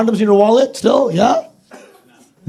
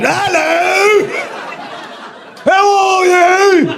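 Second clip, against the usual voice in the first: high, drawn-out mock British greeting; mimicked British accent; 12 semitones higher, much slower than usual. Can't resist mimicking British people, exaggerated British accent. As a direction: burst into an over-the-top British accent. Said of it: "so hard for us not to mimic their accent".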